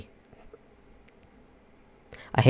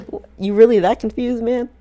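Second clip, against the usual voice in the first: incredulous; 10 semitones higher than usual. Retold or imitated. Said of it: "I was like".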